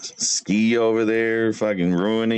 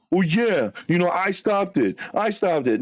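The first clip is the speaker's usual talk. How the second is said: higher, sing-song imitation of a braggart; mock his boast in a higher voice; 5 semitones higher than usual. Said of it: "you're like".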